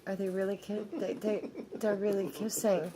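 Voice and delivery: ordinary talk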